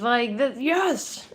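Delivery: high, loud burst of joy; excited yes. Retold or imitated. Reader voicing the winner's loud cry of joy. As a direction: shout the yes like you just won. Excited person celebrating their win.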